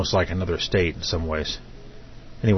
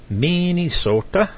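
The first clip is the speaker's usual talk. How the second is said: put-on high, slow, sing-song Swedish accent on Minnesota; a stretched, lilting Swedish take on Minnesota; 10 semitones higher, slower than usual. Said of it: "uh, Swedish, uh, accent there".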